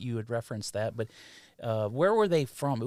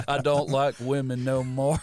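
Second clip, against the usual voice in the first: mocking Cage's accent; 2 semitones higher, 5 dB louder than usual. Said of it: "That was a bad accent".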